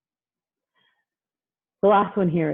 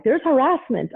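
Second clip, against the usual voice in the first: higher, louder mock alarm; alarmed parents; 8 semitones higher, 4 dB louder than usual. Parents reacting to camp jokes, mock alarm. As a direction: sound scandalized. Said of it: "parents are like".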